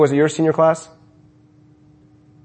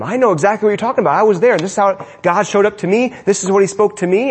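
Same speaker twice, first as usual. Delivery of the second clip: higher, rapid parent's pep talk; eager encouragement; 5 semitones higher than usual. Acted. Parent encouraging a child who met God, eager encouragement. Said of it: "begin to encourage them".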